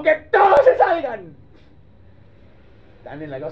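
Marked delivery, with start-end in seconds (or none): 0.0-1.4 high, loud, crying command; tearful order. Acted. Joseph to the servants, high and tearful command. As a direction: cry it out as you send them away.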